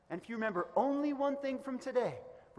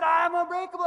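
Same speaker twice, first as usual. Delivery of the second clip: high, very loud "I'm unbreakable"; full yell; 9 semitones higher, 12 dB louder than usual. Retold or imitated. Photographer pushed to yell it even louder, full-throated shout. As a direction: let it rip across the ice. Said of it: "loud as you can".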